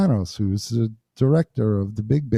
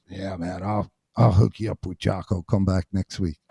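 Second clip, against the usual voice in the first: laid-back teacher; 2 semitones lower, 3 dB quieter than usual. Retold or imitated. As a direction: lazily promise with soft mumbling. Jerry's promise, retold by [name].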